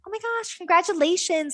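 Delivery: high, hammed-up gushing over his rank; mock excitement. Girlfriend hams up praise for boyfriend's game rank, mock excited. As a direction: fake big cheer for his gaming win.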